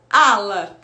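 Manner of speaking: Ala said cleanly, the first sound dropped; word with its first sound dropped. Shown as a demonstration. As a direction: say ala, no m at the start. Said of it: "tirar esse som inicial". (ala dita sem o som inicial)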